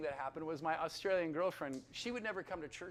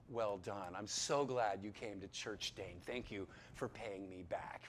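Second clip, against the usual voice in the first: lower, softer mock voice of God; 7 semitones lower, 4 dB quieter than usual. Mock God thanking him for coming to church, lower and soft. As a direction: lightly voice a pleased God. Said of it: "as if God in heaven is like".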